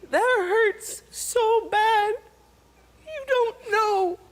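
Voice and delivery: high, very slow, pained words; hurt and breaking. Real, not acted. Daughter telling her father figure how badly he hurt her, pained and slow. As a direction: let the hurt crack through, drawn out.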